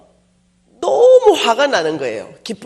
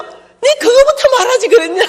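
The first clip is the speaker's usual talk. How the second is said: very high, loud laughing cry; laughing exclamation; 7 dB louder than usual. Retold or imitated. Preacher delighted by the car offer, high laughing cry.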